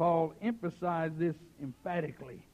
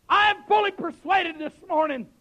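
raised, ringing preacher's cry; emphatic pulpit declaration; 10 semitones higher, 11 dB louder than usual. Real, not acted. Preacher restating his conviction to the church, emphatic declaration. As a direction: declare it forcefully to the whole room.

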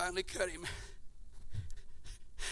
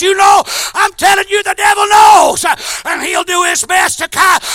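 ordinary talk, nothing marked

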